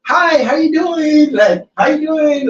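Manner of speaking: very high, drawn-out drive-thru greeting. Retold or imitated. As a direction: greet customers in a slow, sing-out high girly voice.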